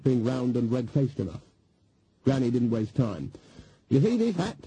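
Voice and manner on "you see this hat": put-on much higher, insistent old witch; demanding. Granny confronting the nervous hotel clerk, demanding. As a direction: push it at him as a demand. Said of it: "she demanded".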